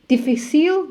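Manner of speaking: "Difficile" said the French way, stress on the last syllable; French accent. Shown as a demonstration. Coach showing how to say words in France, French accent. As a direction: give "Difficile" a French lilt. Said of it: "just put a French accent".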